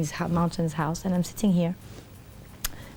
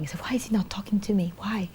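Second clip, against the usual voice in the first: tearful whisper; 3 dB quieter, faster than usual. Retold or imitated. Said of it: "I start crying".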